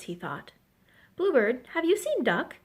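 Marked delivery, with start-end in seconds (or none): 1.2-2.6 carefully asked, pitched up; careful question. A narrator's reading. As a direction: ask it gingerly, voice lifting. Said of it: "he asked carefully".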